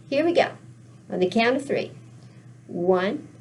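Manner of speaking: usual speaking voice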